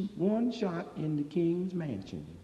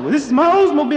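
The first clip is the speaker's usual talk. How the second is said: owner's high protest; 8 semitones higher, 12 dB louder, faster than usual. Acted. Car owner voiced in story.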